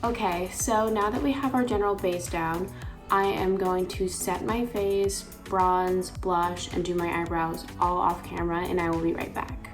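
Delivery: usual speaking voice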